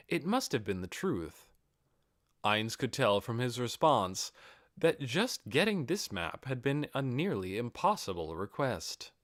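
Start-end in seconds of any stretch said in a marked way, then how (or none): none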